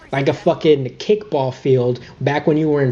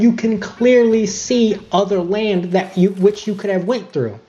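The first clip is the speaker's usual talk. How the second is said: ordinary talk, nothing marked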